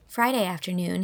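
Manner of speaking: plain reading voice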